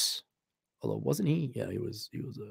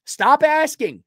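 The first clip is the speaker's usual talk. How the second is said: mock exasperation; 10 semitones higher, slower than usual. Exasperated reply to questioner.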